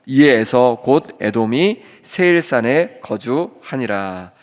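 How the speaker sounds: solemn congregational read-aloud in even phrases; scripture reading. Acted.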